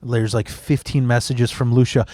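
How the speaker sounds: normal talking voice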